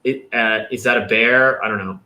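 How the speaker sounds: usual speaking voice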